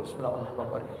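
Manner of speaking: usual speaking voice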